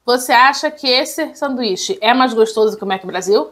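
fry at the lips; speaking between small bites; tags: talking while nibbling food. Real, not acted. Seen 0: Holds fry throughout, brings it to her lips near the end.

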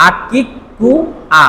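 আকিকুয়া said very slowly, syllable by syllable; slow katakana reading. Shown as a demonstration. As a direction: drag out আকিকুয়া for learners. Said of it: "কি শব্দটা?".

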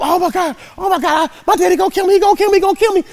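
high, loud frantic panic. Retold or imitated. Boy who shot out his dad's car window, loud high panic. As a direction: spill it out terrified of your dad. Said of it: "in panic mode".

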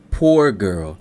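ordinary delivery

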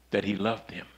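ordinary talk, nothing marked

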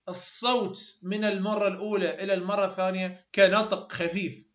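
ordinary delivery

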